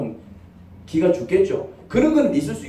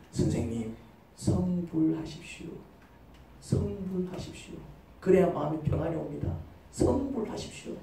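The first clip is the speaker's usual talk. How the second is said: Buddhist teacher; 2 semitones lower, 12 dB quieter than usual. Acted. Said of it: "불교는 뭐라고 얘기합니까".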